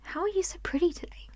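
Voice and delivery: hushed, quick and tender; soft whispered compliment. Shown as a demonstration. Vlogger demonstrating a compliment as a question, whispered. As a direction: coo it gently as a question. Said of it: "if I came up and said".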